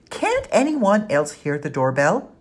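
called out up the stairs; calling out. A narrator's reading. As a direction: call down through the house. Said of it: "she called".

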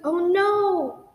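loud high alarmed cry. Acted. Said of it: "I cried out".

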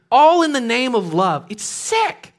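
high, loud disgust; outraged. Real, not acted. Preacher condemning the crime, outraged. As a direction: cry out in outrage.

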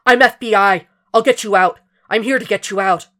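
loud, high, firm promise; urgent reassurance. A narrator's reading. Will pulling the terrified woman close, loud urgent reassurance. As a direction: shout comfort over the flames.

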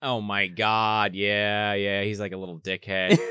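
deep, slow, quiet disdain; low aside. Real, not acted. Friend comments on the bratty kid, low and slow. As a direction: mutter it down low in disgust.

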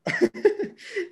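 short high laugh. Real, not acted. Laughing at the idea of putting Mars, high giggle.

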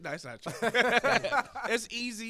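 high, loud laughing protest; laughing that it's no trap; laughing denial. Real, not acted. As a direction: laugh it off while insisting.

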